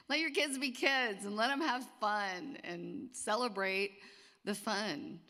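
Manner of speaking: rally the parents, drawing it out; excited exhortation. Real, not acted.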